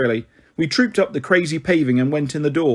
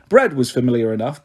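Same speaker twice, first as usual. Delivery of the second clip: ordinary delivery, nothing marked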